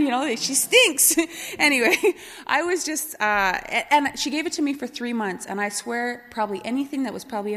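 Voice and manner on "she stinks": put-on taunting kids.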